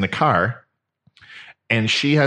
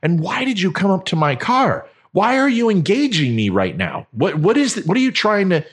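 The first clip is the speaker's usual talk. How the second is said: high-pitched, insistent volley of questions; retold exasperated confrontation; 8 semitones higher than usual. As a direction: reenact protesting, demanding an explanation. Acts out confronting the angry driver.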